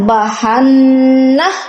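slow chanted ইন্না Samuda with ghunna; Quran recitation. Acted. Recites verse slowly. (ধীর সুরেলা তিলাওয়াত)

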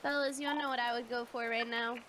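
normal talking voice

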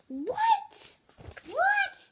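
very high squeaky What; mock shock. Reader shocked at being called a robot, squeaking. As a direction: squeal it like you can't believe it.

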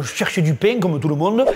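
ordinary delivery

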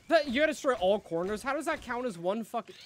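high, loud indignant yelling; loud angry protest against the rules; outraged shout. Real, not acted. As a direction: yell at the screen in disbelief. Outraged at game objective rules.

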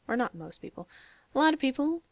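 ordinary talk

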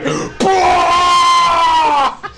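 high, loud drawn-out yell; mock explosive roar. Host roaring after calling a knockout, hammed-up yell. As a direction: let out a huge hammed-up roar.

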